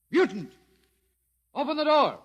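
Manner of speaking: loud commanding call at the door. Acted. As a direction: bellow at the mutant to come out. Lieutenant orders door opened.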